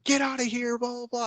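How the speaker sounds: high-pitched but hushed imitation of screaming; mock scream. Retelling man screaming at the visitor, mock scream. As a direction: scream in miniature, high and soft. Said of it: "He starts screaming at me".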